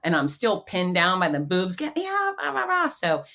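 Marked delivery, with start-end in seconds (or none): 1.8-2.9 high-pitched panicked begging, retold; hammed-up high wail trailing into blah-blah; mock screaming plea. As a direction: imitate yourself frantically begging to be let out. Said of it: "They're screaming and flailing and begging".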